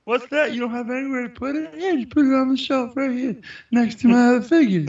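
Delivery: high, quick, sugary voice; chirpy and eager, a bit rushed; silly high voice. Pretend. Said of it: "Why are we talking like that?".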